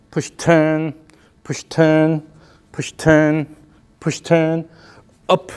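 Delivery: steady beat of push, turn and up cues; rhythmic coaching calls. Shown as a demonstration. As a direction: count every move in time. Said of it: "The action is up".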